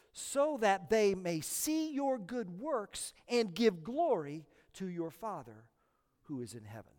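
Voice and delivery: calm, measured reading of Scripture. Acted. Reads the Scripture passage.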